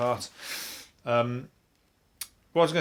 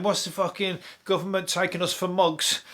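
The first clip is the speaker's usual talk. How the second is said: higher-pitched, lightly put-on Cockney; Cockney accent; 5 semitones higher than usual. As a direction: imitate an indignant bishop as a Cockney. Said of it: "Sound like a Cockney?".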